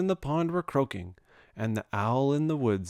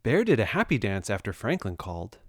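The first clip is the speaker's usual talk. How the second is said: plain reading voice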